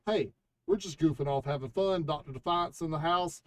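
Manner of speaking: normal talking voice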